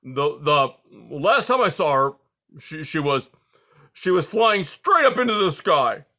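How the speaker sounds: put-on a fussy old porcupine getting worked up; old porcupine, flustered. A narrator's reading. Aerith's voice in the story.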